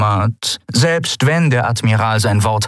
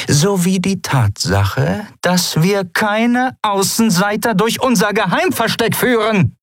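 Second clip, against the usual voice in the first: drawing out the accusation; Zaylar's voice; 14 semitones higher than usual. Acted. Captain accusing Sephiron of leading outsiders, cutting.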